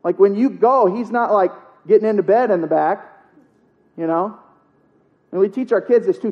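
normal talking voice

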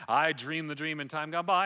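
loud, emphatic quoted lyric; strong. Retold or imitated. Preacher retelling how she sings it strong, forceful delivery. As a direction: belt the line out, imitating a strong singer. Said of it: "she sings it strong".

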